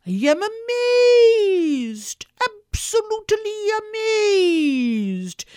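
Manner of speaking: high, loud, long-drawn astonishment; mock amazement. Popper finding candy in his pocket, hammed-up amazement. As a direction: stretch out the pretend astonishment theatrically, pitch soaring.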